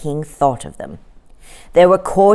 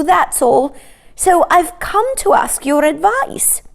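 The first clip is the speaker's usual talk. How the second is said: cow's plea; 10 semitones higher than usual. A narrator's reading. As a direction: plead your case in a high voice. Cow pleads with king.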